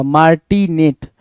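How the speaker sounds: मार्टिनेट said slowly, each syllable clear; slow, careful teacher demo of मार्टिनेट; slow model word. Shown as a demonstration. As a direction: spell out मार्टिनेट slowly for the class. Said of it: "ये सही होगा".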